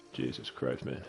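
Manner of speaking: quiet mutter. Real, not acted. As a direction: mutter it under your breath. Horse dying in game.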